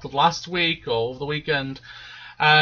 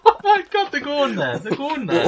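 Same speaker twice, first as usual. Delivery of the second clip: laughing disbelief; 11 semitones higher than usual. Retold or imitated. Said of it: "I was like".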